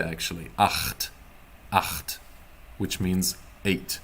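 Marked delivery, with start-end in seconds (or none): 0.6-2.2 Acht said twice with the ch scraped at the back of the throat; German Acht with a throat ch; throaty acht. Shown as a demonstration. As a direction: gargle the ch in Acht. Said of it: "it happens back here".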